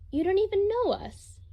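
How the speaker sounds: put-on high, bright child's chirp. Young boy interrupting his grandfather, high chirp. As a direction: pipe up high like a small child. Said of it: "chirped a previously quiet voice".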